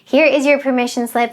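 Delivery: ordinary talk, nothing marked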